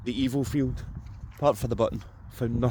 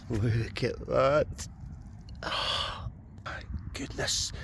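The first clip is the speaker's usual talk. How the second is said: slow, hushed, breathless with wonder; almost whispering at the find; awed whisper; 5 dB quieter, much slower than usual. Real, not acted. To viewers over a treasured find, slow awed whisper.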